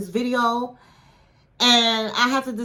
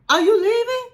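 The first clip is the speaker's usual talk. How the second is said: surprised question; 10 semitones higher, 4 dB louder than usual. Retold or imitated. Vanya reacting to him leaving.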